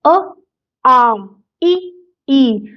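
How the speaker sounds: अ आ इ ई said one by one, clearly; vowel pairs again. Shown as a demonstration. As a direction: sound out each pair slowly. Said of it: "अगर हम इस तरह से लिखें". (स्वर जोड़े साफ़ बोलें)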